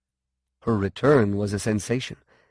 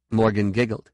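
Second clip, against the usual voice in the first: ordinary delivery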